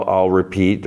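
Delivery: ordinary delivery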